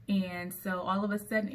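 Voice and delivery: ordinary delivery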